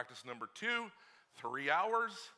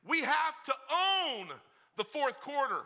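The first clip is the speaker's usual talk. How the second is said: shouted, much higher and slow, OWN stretched; loud repeat; 10 semitones higher, 7 dB louder, slower than usual. Shown as a demonstration. Preacher asking the dads if they heard, now shouting. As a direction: hammer it home the second time. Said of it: "Did you hear me".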